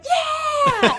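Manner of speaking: the birth room cheering her on; cheering shout. Retold or imitated. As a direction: cheer like a crowd at a rock concert.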